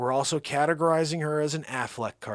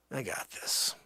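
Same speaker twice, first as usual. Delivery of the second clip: whisper; 4 semitones lower, 10 dB quieter than usual. Acted. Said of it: "say to Bruce Willis".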